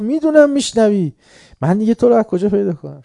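very high, loud angry yell; angry shouting. Retold or imitated. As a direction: shout him down, you know he hears you. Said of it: "شروع کرد داد زدن". (فریاد خشمگین)

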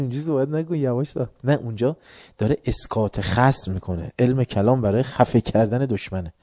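normal talking voice

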